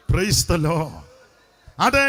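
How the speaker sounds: ordinary talk, nothing marked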